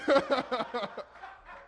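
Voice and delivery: laughing at his own joke; hearty laugh. Real, not acted. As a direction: burst into a trailing chuckle. Laughs at own Blu-ray joke.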